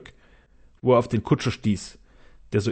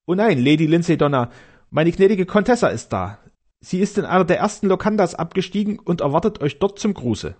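higher, eager servant voice; eager castellan; 5 semitones higher than usual. Acted. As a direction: rush to reassure the lady. Castellan reports.

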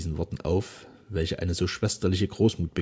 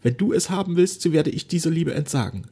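higher, halting, anxious daughter; faltering; 9 semitones higher, 6 dB louder than usual. A narrator's reading. Frightened daughter pleading with her silent father, haltingly. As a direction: stumble through the plea in fear. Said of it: "sie sagte stockend".

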